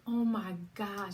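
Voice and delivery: slow, loud oh my gosh; a moved, drawn-out exclamation; overwhelmed gasp. Retold or imitated. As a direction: let it sink in with awe. Hearing her number dropped.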